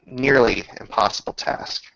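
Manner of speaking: ordinary talk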